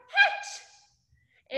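sudden high-pitched pop of a word; excited call-out. Pretend. Storyteller cueing children to burst from eggs, excited squeal. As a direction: burst out like a hatching egg.

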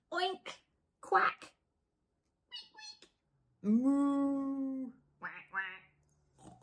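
playful farmyard noises; animal noises. Acted. Reading the animals' noises to children, playful. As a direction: make each animal's call.

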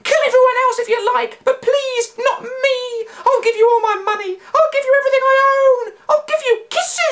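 very high, fast, loud babble; frantic high pleading. A narrator's reading. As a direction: gabble every offer in panic. Annie Beth pleading.

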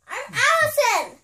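insistent shout. Real, not acted. Kid insists on being Allison.

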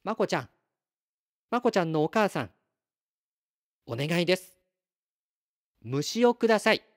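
やや高めの声で丁寧に頼みこむ; 区切りながら、相手に呼びかけて願い出る; お願いする星の子. Acted. 星の子が虫をねだる. (星の子が懇願する声、軽く演じて)

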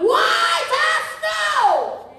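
long ringing shout; shouted climax. Acted. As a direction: hold it and cry it out to the roof. Climax of whooped sermon.

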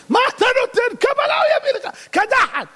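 high, indignant comeback in a put-on voice; hammed-up indignant retort; mocking outraged Persian. Persian mocks Iraqi in joke.

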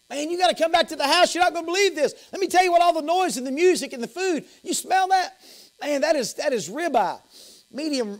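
high-pitched, eager acted invitation; excited urging. Servant calls older brother back to the feast, excited. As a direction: coax him along, bubbling over with news.

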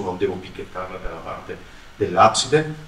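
usual speaking voice

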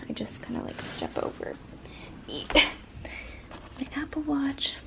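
hushed unvoiced whisper; quiet whispered aside. Real, not acted. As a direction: whisper so as not to wake anyone. Whispering while packing devices.

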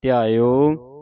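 ਧਿਆਇਓ rising on a long open o; lengthened ending. Shown as a demonstration. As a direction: stretch ਧਿਆਇਓ out at the end. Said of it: "ਇਸ ਤਰ੍ਹਾਂ".

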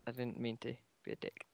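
sheepish whisper. Real, not acted. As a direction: whisper the apology under your breath. Sheepish apology to friend.

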